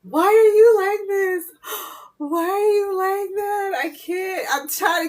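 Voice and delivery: high, loud, slow words through real laughter; laughing helplessly. Real, not acted. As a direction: crack up so hard you can barely get it out. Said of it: "Y'all making me laugh".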